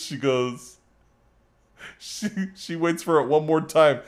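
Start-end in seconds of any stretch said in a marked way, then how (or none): none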